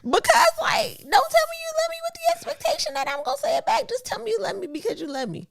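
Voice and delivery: high, pleading insistence; plead, voice climbing high; pleading high for genuine love; impassioned plea. Real, not acted.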